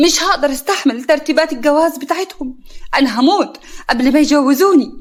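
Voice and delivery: loud, high, desperate protest; angry outburst. Acted. Laila cries out to Sara that she cannot bear the marriage, loud and angry. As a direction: shout her refusal at her cousin.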